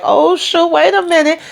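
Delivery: high, startled yelp of surprise; startled onlookers, high mock surprise. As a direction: jump up an octave like you just got caught. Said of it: "So they like".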